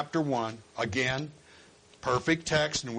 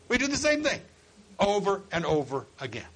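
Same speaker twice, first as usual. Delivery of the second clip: ordinary talk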